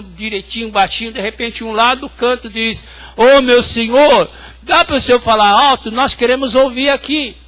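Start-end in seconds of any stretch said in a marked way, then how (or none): 3.1-7.4 calling out. Retold or imitated. Patient calls from the ward corner.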